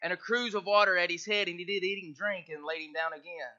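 soft, measured Bible reading; hushed King James reading; scripture reading. Acted. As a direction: read the verses gently to the church.

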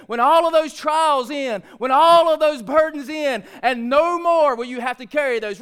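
sustained pulpit fervour, building in force; rolling, insistent sermon cadence; impassioned preaching. Real, not acted. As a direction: preach it hard, driving towards a loud peak.

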